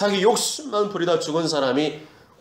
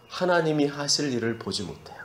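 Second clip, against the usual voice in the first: usual speaking voice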